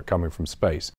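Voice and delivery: usual speaking voice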